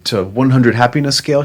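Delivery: husband marvelling at his wife's answer, excited; delighted disbelief. Retold or imitated.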